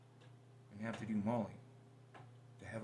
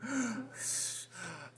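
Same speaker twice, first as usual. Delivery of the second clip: big high mock gasp; 13 semitones higher, 4 dB louder than usual. Mock gasp mimicking partner jumping to conclusions. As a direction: gasp theatrically to mock her. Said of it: "jumping to conclusions, going".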